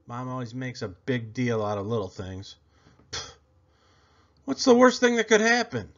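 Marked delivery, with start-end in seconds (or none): none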